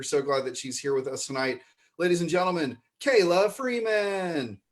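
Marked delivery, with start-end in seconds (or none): 2.0-4.6 announcer introduction. Real, not acted. Host presenting a dear friend to the audience, announcer style. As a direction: stretch the name like a ring announcer.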